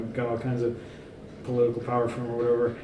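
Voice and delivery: ordinary talk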